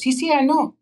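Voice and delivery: very high Spanish-tinged sí sí; taco truck guy. Retold or imitated. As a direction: squeak it out with a light Mexican accent. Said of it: "the guy in the taco truck goes".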